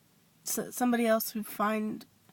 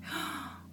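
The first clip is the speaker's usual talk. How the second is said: quick, soft mock gasp; hushed intake of anticipation, acted out; 8 dB quieter than usual. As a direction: gasp as if eager for news. Acts out reaction to his news.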